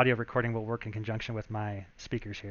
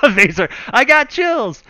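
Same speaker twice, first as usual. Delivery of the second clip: excited; 11 semitones higher, 11 dB louder, slower than usual. Real, not acted. Excited reaction to own sample.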